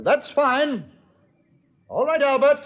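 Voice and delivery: calling out. Acted. Signals henchman to fire.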